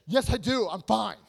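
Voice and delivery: loud, snapped denial; defensive yell. Acted. Friend denying a bad mood to a questioner, defensive yell. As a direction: yell it while insisting all is well. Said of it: "You don't yell at me like this".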